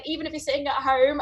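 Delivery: ordinary delivery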